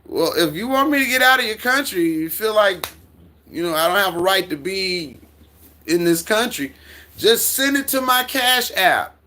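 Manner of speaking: playfully defiant, voice up high. Real, not acted. Defiant reply to haters.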